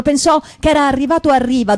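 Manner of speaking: ordinary delivery, nothing marked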